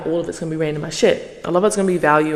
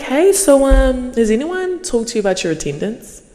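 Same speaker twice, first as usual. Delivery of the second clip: higher, sing-song mock manager voice; mock polite manager; 7 semitones higher than usual. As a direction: ape the polite boss asking about attendance. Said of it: "They're like".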